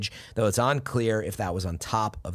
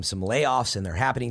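usual speaking voice

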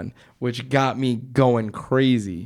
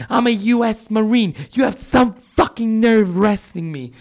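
higher, raised mock yell of an angry woman; mock scream; 8 semitones higher than usual. Arrested girl yelling at the cops, mock shout. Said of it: "this girl's screaming".

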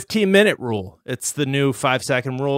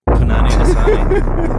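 excited hype; 8 dB louder than usual. Real, not acted. Host hypes guest's rap name.

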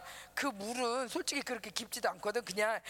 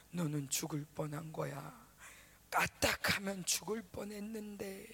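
low, slow ominous voice; haunting inner voice; 6 semitones lower, 4 dB quieter, slower than usual. Retold or imitated. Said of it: "그러면서 자꾸 그 장면이". (낮고 느린 불길한 목소리)

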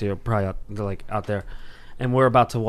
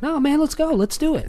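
hyped-up buddy. Retold or imitated. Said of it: "he's like".

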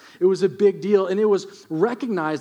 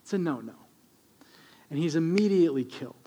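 ordinary talk, nothing marked

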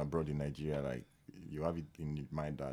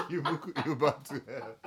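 laughing; 9 semitones higher, 6 dB louder than usual. Real, not acted. Laughing joke.

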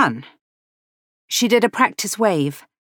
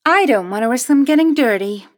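higher, playfully pert reply; deliberately pert; 6 semitones higher, 4 dB louder than usual. A narrator's reading. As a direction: answer back with cheeky primness. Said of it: "in a deliberately pert tone of voice".

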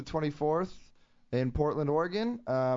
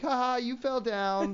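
teasing laugh; 7 semitones higher than usual. Retold or imitated. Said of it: "You're supposed to be like".

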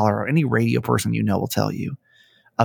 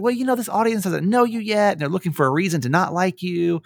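mock wise boss; 7 semitones higher than usual. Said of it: "It's like".

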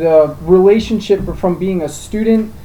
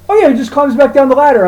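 casual friend; 8 semitones higher, 5 dB louder than usual. Retold or imitated. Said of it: "He's like".

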